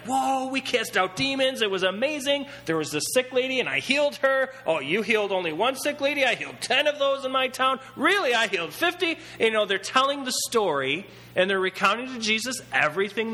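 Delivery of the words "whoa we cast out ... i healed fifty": high, bragging, overexcited voices; excited disciples. Retold or imitated. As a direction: brag like thrilled kids back from a trip. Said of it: "They're like".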